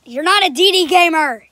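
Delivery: loud exasperated yell. Real, not acted. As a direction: shout it across the room. Yelling at dad in closet.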